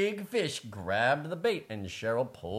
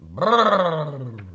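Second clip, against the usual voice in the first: louder lip-trill shiver; cold shiver brrr; 5 dB louder than usual. Acted. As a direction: shiver through the lips for the cold. Story shivering sound.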